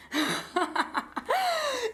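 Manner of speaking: high giggling laugh. Real, not acted. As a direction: burst into a real laugh. Laughing at her own eagerness.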